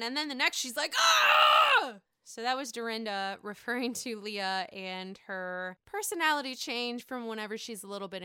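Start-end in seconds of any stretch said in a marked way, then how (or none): 1.0-2.0 sudden piercing high shriek, much louder; wild shriek. Retold or imitated. Mimicking Leah's drunk switch with a piercing shriek. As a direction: flip from friendly to a wild scream. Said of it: "going from this end to the other end".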